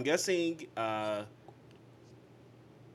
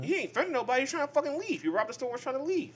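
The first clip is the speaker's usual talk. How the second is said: much higher, louder incredulous pushback; heated protest; 4 dB louder than usual. Real, not acted. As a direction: argue it's not your problem. Heated argument about helping.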